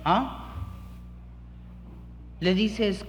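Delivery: ordinary talk, nothing marked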